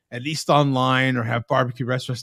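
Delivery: ordinary talk, nothing marked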